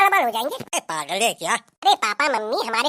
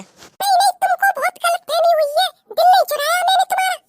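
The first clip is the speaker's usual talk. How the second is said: high nurse voice, a bit louder; 3 dB louder than usual. Acted. Nurse rebuffing a lovestruck patient, high and firm. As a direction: set him straight in the nurse voice.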